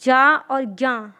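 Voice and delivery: slow, separated जा और ग्या; deliberate teacher sounding-out; slow spelling out. Shown as a demonstration. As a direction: spell each sound out slowly for kids. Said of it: "ग्या में क्या बनती है?".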